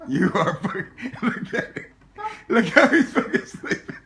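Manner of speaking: breathless wheezing laughter; can barely talk for laughing. Real, not acted. Laughing at his passed-out friend, wheezing.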